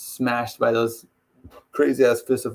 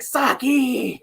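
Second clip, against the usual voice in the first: screaming; 9 semitones higher than usual. Retold or imitated. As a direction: imitate a desperate movie scream. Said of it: "He's, like, screaming".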